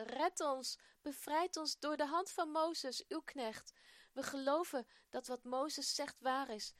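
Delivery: pleading prayer. A narrator's reading. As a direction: plead with God for rescue. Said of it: "papa smeekt God". (luid en dringend smekend gebed)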